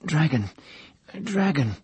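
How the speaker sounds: high, broken stammer; forcing out a stammered word. A narrator's reading.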